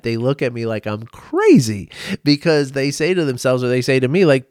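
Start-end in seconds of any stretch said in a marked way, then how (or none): none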